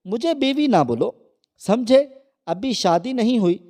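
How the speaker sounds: furious yell down the phone at fiancé; angry screaming. A narrator's reading. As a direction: scream it at him in rage. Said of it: "غصے سے چیخنے چلانے لگی".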